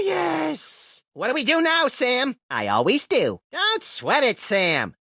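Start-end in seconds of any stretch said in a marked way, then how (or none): none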